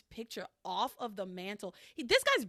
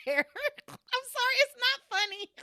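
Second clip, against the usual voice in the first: giggling through the apology, pitched way up; can't stop laughing while saying sorry; 13 semitones higher, 5 dB louder, slower than usual. Real, not acted.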